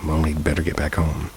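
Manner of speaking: ordinary delivery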